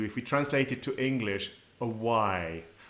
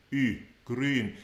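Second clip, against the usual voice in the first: normal talking voice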